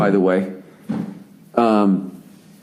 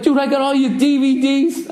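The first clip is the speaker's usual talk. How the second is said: much higher, giddy fanboy gush; 11 semitones higher than usual. Retold or imitated. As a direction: blurt it out like an overexcited fan. Fan gushing at drummer.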